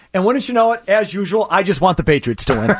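can't keep a straight face; trying not to laugh. Real, not acted. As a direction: laugh breaking through the words. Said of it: "I couldn't even say it with a straight face".

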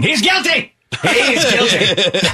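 high-pitched, drawn-out imitation of a shouter; hammed-up cry of protest, far above normal voice; mock shouting. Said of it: "he just kept shouting".

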